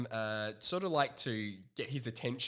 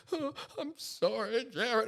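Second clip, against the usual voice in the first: high, slow pained sorry; imitating injured player apologising; mock pained apology; 8 semitones higher, slower than usual. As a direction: apologise through the pain. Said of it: "He goes".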